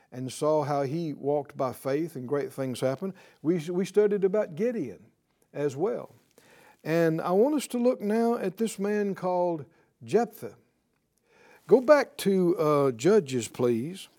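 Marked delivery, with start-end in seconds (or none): none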